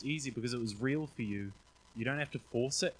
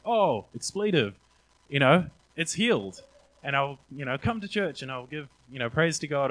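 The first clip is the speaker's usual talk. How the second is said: amazed outburst; 4 semitones higher, 8 dB louder than usual. Retold or imitated. Boss stunned her ankle is healed, loud amazed outburst. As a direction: blurt out the amazement at the healing.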